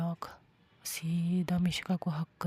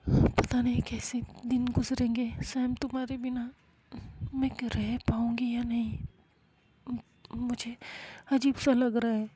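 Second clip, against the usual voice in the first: mild tearful dread; 5 semitones higher than usual. Acted. Mishka tells Sam she can't bear the days without her, mildly tearful and higher. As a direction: let the fear of missing her lift the pitch.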